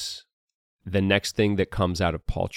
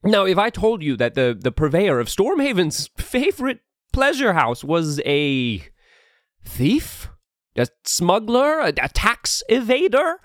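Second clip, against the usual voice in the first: lightly voiced grand duke; ominous regal speech; 7 semitones higher than usual. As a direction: denounce traitors like a smooth ruler. Duke denounces criminals.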